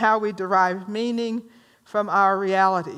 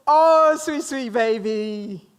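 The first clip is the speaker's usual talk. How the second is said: cooing; slower than usual. Retold or imitated. Teacher voicing her own reality about Kibbles, slow cooing. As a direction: gush over the puppy. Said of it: "there's my reality".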